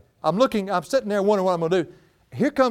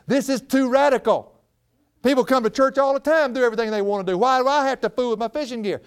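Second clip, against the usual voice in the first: raised, higher protest against God; rebellious outburst; 9 semitones higher, 3 dB louder than usual. Retold or imitated. Preacher reliving his protest to God, raised and indignant. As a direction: rail at the unfair order, pitched up.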